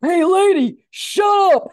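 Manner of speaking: loud, high heckle from the back; imitating a heckler yelling to shut up; mock heckle. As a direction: shout her down with a laugh. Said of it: "somebody ... went".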